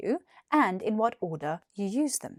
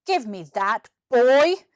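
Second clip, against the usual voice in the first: put-on louder, furious teacher shout on boy; angry shout; 3 semitones higher, 6 dB louder, slower than usual. Deputy headmaster grabbing for the wand, angry shout. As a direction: bark it like a grumpy headmaster. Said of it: "he shouted".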